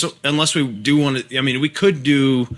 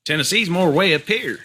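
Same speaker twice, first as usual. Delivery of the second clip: Appalachian twang pitched way up; Appalachian accent; 4 semitones higher than usual. Shown as a demonstration. Showing friends how Tennessee differs from Southwest, high Appalachian accent. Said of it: "we could go Appalachian".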